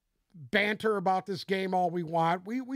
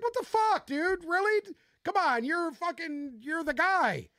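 exasperated retold rant; 8 semitones higher than usual. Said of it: "And I was like".

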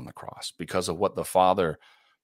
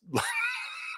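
high burst of laughter; 5 dB quieter than usual. Real, not acted. Laughing about swiping headlines away.

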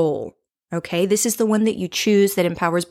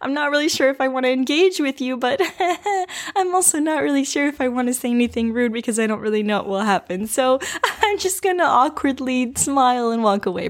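higher, fast, giggling through the words; nervous laughter; 5 semitones higher than usual. Retold or imitated. Woman answering a street catcaller with nervous giggly laughter. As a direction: laugh it off awkwardly, unsure and uneasy. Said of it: "nervous laughter".

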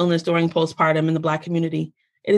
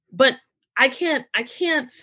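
usual speaking voice

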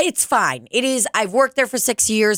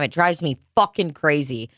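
ordinary talk, nothing marked

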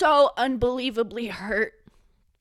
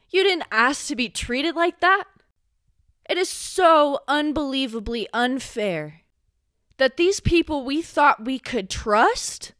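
normal talking voice

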